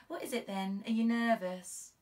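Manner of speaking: prying junior officer; a touch of teasing concern; young constable, curious. Acted. Constable probing her sergeant.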